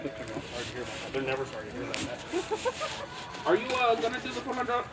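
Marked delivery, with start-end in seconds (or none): 0.0-3.4 quiet aside. Real, not acted. Muttered aside, much quieter.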